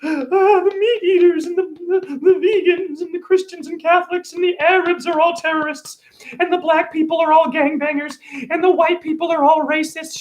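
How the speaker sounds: high, whiny mock panic voice; mocking doom-sayer. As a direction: parody a hysterical complainer in a shrill falsetto. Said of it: "how many are just showing you how".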